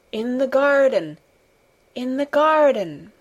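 a distant voice calling out; dream voice calling. A narrator's reading. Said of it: "the voice which called back to him".

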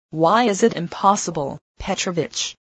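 plain reading voice

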